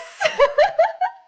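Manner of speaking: high, loud burst of laughter; triumphant laugh. Real, not acted. Player after finally getting a yes, loud high laugh.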